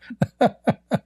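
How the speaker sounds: short high laughing bursts; laughing at his own mistake; high giggle. Real, not acted. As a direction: giggle at the twist. Laughs at the twist.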